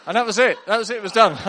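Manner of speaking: high, loud, incredulous punchline; loud mock-exasperated payoff. Real, not acted. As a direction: belt out the deflating ending. Punchline of the failed joke.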